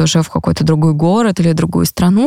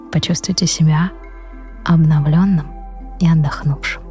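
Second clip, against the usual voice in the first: guided meditation; 3 semitones lower, 5 dB quieter than usual. Acted. Ends the meditation.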